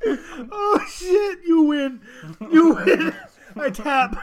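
high, cracked-up concession; helpless laughing surrender. Real, not acted. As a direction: give up through tears of laughter. Conceding after hilarious impression.

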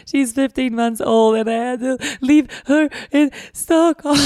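high, loud, slow tearful words; really crying while telling it. Real, not acted. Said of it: "I'm crying right now just saying it".

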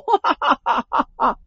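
big villainous burst of laughter; loud laugh. A narrator's reading. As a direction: laugh out long like a gloating demon. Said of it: "这锦衣人大笑". (得意的魔头式大笑)

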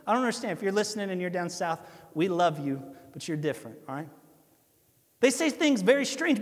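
ordinary talk

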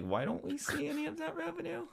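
high squeaky voice, half laughing; mock whiny complaint. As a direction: whine it out in a high pitch. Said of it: "and I'm like".

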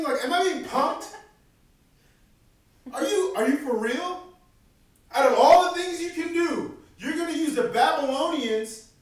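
high, loud disbelieving rant at God; incredulous outburst. Retold or imitated. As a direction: protest like you think you're being pranked. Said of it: "Habakkuk gets so angry".

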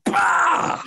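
high, loud shouted bang noise. Acted. Ogre knocking on the office door, loud shouted bang. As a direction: belt out the knock as a sharp bark. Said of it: "slams it against the door real hard with a loud".

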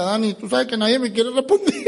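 mock complaint rising at the end; complaining team member. Blunt recruit reports nobody answers, mock plaintive. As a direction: imitate a puzzled recruit, voice climbing on the last word. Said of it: "Y después venía y me decía".

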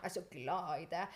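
«Jeg er så glad i deg» low and flat, not meant; monotone, half-hearted «Jeg er så glad i deg»; insincere. Shown as a demonstration. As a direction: toss off «Jeg er så glad i deg» without meaning it. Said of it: "jeg sier det fordi det høres fint ut, men jeg mener det egentlig ikke".

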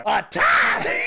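shouted mocking repeat of a tie; incredulous shout. Host mocking the caller's tie prediction, shouting. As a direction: throw the word back at him, loud.

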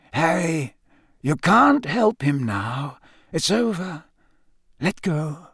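put-on slow, gentle old man's coaxing. Dumbledore gently coaxing Harry to release Cedric, slow. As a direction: ease him into letting go.

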